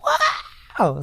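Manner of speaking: high mock crowd cheer with a laugh. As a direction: play the audience roaring back. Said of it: "then people are like".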